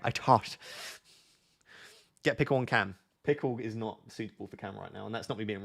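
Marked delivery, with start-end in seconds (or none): 0.0-0.6 "tot" for thought, Irish-style; amateur Irish "I tot"; attempted Irish accent. Shown as a demonstration. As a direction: put on an Irish accent with a hard t. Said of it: "that's my Irish accent".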